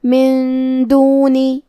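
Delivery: من دونی slowly, the نون hidden with ghunna before دال; nasal hidden nun in من دونی. Shown as a demonstration. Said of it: "حکم کو غنہ کے ساتھ ادا کریں گے". (من دونی میں اخفاء)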